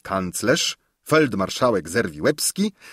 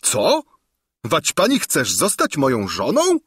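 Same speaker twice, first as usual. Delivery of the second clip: put-on raised, astonished young king; shocked shout; 6 semitones higher, 4 dB louder than usual. A narrator's reading. King cries out at the governess's claim, raised and astonished. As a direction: blurt it out in disbelief. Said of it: "krzyknął Lulejka".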